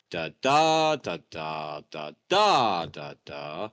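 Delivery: singsong scansion, every other "da" hit hard; poetic meter. Shown as a demonstration. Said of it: "poetry goes where it's like".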